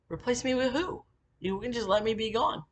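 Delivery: high-pitched indignant questioning; retold protest, pitched well up; incredulous protest. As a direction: push back in retold disbelief. Retold protest at being replaced.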